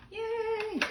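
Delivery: high squealed yay; little high cheer of joy; happy yay. Real, not acted. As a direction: cheer it like a delighted crafter. Pleased with stamped pine cones.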